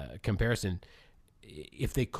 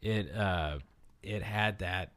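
normal talking voice

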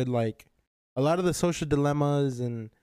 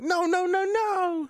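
panicked high voice; slower than usual. Retold or imitated. Panicked character quote.

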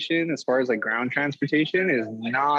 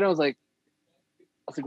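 ordinary talk, nothing marked

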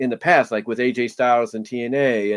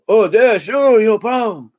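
much higher and louder, slow Texas drawl; Stone Cold impression; 7 semitones higher, 5 dB louder, slower than usual. Host imitates wrestler accepting interview offer, hammed-up Texan drawl. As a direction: ham up a tough Texan wrestler agreeing gladly. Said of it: "my best, uh, Stone Cold impression".

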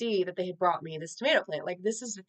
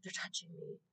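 much softer, barely voiced, in quiet awe; hushed wonder; 17 dB quieter than usual. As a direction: breathe it as a tiny secret thrill. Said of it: "And I'm like".

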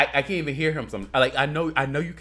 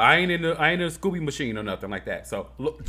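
ordinary talk, nothing marked

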